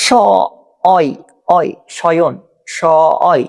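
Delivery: "শয়ন" broken into a long "স", then "অয়"; sounding out. Shown as a demonstration. Teacher breaking শয়ন down for students, sounding out. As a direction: split "স" and "অয়" apart, holding each. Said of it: "তাহলে অয় হলে কি হবে?".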